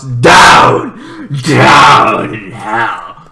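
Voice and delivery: slow, loud, hammed-up demon voice; mock hellish growl calling for comments; mock demonic voice. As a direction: boom it like a devil from below. Jokes comments go down in hell.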